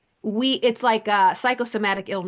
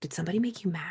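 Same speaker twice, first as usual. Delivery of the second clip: whispered mock question; 8 dB quieter than usual. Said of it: "I got that all the time".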